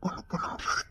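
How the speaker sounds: soft, hopeless whisper. Acted. Man telling her he is helpless, whispering. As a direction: barely voice it.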